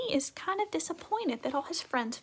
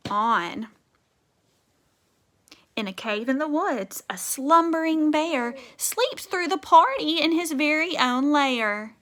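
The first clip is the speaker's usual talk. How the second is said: put-on lively, louder read-aloud for kids; storybook reading; 7 dB louder than usual. A narrator's reading. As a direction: read it like a bedtime book full of rhythm. Teacher reads picture book aloud.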